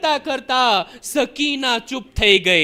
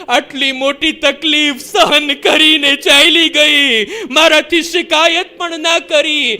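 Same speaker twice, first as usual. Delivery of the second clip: preaching at full force; 3 semitones higher, 4 dB louder than usual. Acted. Preacher to mourners, full-force lament. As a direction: drive the grief home to the crowd.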